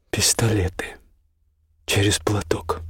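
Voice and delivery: quiet, nearly whispered reply; hushed and weary, barely voiced. A narrator's reading. Sick count answers his friend about the duel, hushed.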